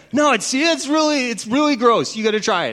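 high, loud urging; mock tipsy insistence; insistent party guest. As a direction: push him to take the shot. Neighbor insists he try it.

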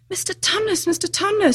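high, distressed pleading call; great distress. A narrator's reading. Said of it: "said Lucy in great distress".

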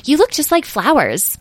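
high-pitched admiring wonder; delighted, way up in pitch; exclaiming. A narrator's reading. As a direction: admire them in a high character voice. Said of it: "exclaimed Edwin".

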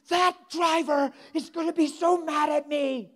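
high, loud, slow mock wail; granny's frantic fit. Preacher imitates grandma dreading the driver, frantic. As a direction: wail each word out in exaggerated distress. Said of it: "now Granny's having a fit".

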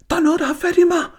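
very high, loud, frantic cry; panicked shriek. A narrator's reading. Jakob pretending something grabbed him, shrieking. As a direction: scream like something is dragging you down.

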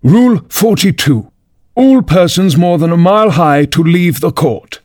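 high, formal proclamation; reading out a rule. A narrator's reading. King reads from his book to the court, proclaiming. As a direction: read it out as an official decree. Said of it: "read out from his book".

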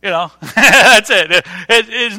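amused chuckle through the words; real laugh breaking into speech. Real, not acted. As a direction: laugh while wrapping up the anecdote. Laughs at own son-in-law story.

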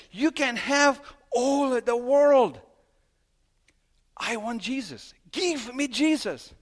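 loud, high, slow declaration; Peter declaring. Retold or imitated. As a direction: proclaim it slowly like a fervent pastor. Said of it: "how one pastor said".

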